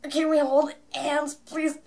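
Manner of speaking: loud, high-pitched eager begging; excited pleading. Retold or imitated. Fan asking idols for the hand-holding pose, eager pleading. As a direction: beg for it, bursting with excitement.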